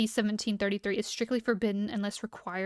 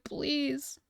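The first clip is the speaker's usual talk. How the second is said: showing a strained voice; 4 semitones higher, 4 dB quieter than usual. Shown as a demonstration. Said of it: "My voice is like".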